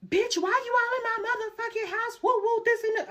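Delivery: high, loud mock girlfriend yell; hammed-up jealous girlfriend voice. Girlfriend yelling retold.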